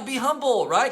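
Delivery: ordinary delivery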